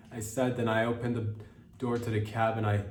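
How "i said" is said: ordinary talk, nothing marked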